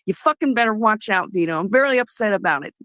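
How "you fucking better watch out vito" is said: ordinary talk, nothing marked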